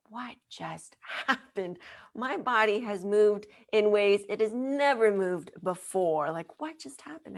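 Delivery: wondering, breathless amazement; astonished. Retold or imitated. Woman asks her healer in amazement what just happened. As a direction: marvel at what the body just did.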